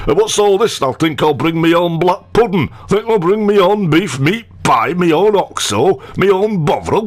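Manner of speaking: high, broad northern voice; Lancashire fan voice. Retold or imitated. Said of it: "I can just imagine".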